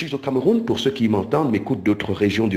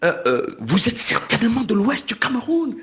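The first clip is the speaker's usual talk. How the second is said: high-pitched, eager guessing of his home region; eager excited questions; 10 semitones higher than usual. Pretend. To the stranger in New York, guessing his village, high and eager. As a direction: pounce on him with delighted questions, voice up high. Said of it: "mais vous allez courir pour dire".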